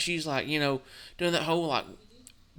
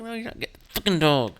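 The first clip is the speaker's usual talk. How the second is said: louder, fast snap; angry refusal; 4 semitones higher, 6 dB louder, faster than usual. Retold or imitated. Answering the ex-wife in the divorce, angry and fast. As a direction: shut her down hard. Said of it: "divorce argument retold".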